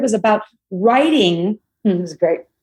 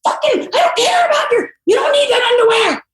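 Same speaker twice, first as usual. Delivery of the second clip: retold furious yell. Mother yelling outside her daughter's room, a full furious shout. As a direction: scream it like a mom at the end of her rope. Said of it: "getting out of my car going".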